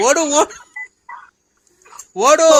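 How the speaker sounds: loud, high barked command; urgent shouting. Real, not acted. Ordering him to run home, urgent shout. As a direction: yell it like chasing someone off.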